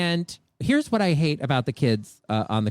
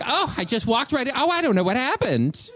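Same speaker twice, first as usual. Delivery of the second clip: feigned innocence; 7 semitones higher than usual. Pretend. Feigns innocence after bumping child.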